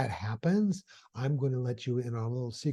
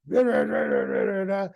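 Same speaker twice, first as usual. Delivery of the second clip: a much lower growling rant of gibberish; mock angry ranting; 13 semitones lower than usual. [name] swears at his boss, low mock-angry gibberish. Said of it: "yells out some explicit, ex-, some bad words".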